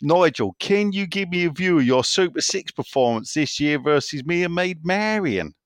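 exaggerated West Country read-out; Doddsy's Somerset accent. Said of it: "I will do his accent".

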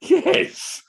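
high, loud hammed-up old-lady surprise; mock triumph. Teasing Chris for the idiom, high hammed-up exclamation. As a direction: ham up a prim well I never.

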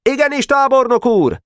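put-on very high, eager boy-soldier call; high-pitched "yes sir" cry; military acknowledgement. A narrator's reading. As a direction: answer the general in a high shouted salute. Soldier boys answer their general.

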